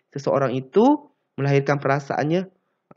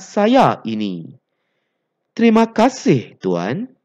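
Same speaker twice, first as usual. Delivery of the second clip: expressive dialogue reading; 5 semitones higher, 4 dB louder than usual. Acted. Reads dialogue aloud.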